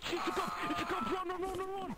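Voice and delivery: high-pitched, urgent alarm; panicked warning. Pretend. Player spots a cop and says run, panicked warning. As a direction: urge everyone to flee, high and frantic.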